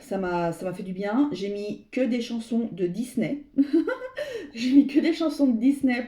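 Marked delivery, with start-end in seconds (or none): 3.5-4.5 high burst of laughter. Real, not acted. Laughs about Disney songs. 4.5-6.0 laughing. Real, not acted. Laughing about Disney songs.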